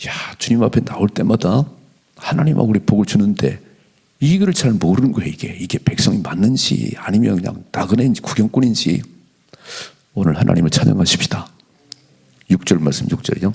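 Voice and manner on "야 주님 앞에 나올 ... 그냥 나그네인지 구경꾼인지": ordinary talk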